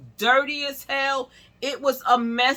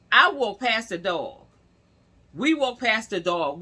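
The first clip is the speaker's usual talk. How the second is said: ordinary delivery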